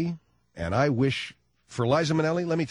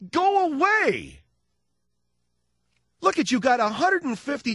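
exasperated; 13 semitones higher than usual. Real, not acted. Comedian yelling at Liza.

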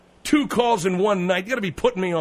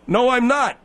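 irritated denial; 5 semitones higher, 4 dB louder than usual. Acted. Host snaps at the hoarse guest.

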